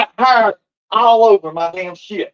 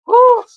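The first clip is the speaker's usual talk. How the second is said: sharp, high "woo" of shock; short high-pitched holler; high loud whoop; 14 semitones higher, 5 dB louder than usual. Real, not acted. Exclaims after breaking up the fight.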